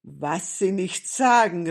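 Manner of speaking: loud interjection; calling out. A narrator's reading. Policeman interrupting Grandmother's news, calling out. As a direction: cut in loudly with astonishment. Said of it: "rief Wachtmeister Dimpfelmoser dazwischen".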